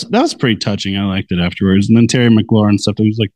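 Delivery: normal talking voice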